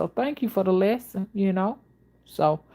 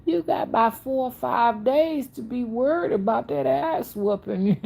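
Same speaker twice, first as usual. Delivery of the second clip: laughing; 6 semitones higher than usual. Real, not acted. Laughs at mother's psychology.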